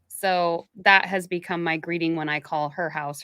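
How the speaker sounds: ordinary talk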